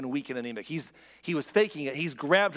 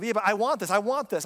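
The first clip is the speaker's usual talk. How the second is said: selfish whine; 5 semitones higher, 4 dB louder than usual. Retold or imitated. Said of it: "and say".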